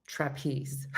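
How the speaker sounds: ordinary talk, nothing marked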